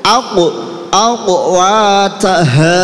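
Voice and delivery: "aku" bounced, "wataha" slow and chanted; qalqalah. Shown as a demonstration. Teacher leads a repeat-after-me drill, qalqalah on "aku". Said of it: "yang penting mantul".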